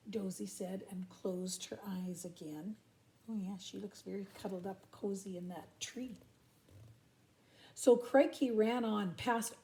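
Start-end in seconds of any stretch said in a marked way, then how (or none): 0.1-6.2 soft hush for a sleeping koala; whispering. Acted. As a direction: whisper so as not to wake her.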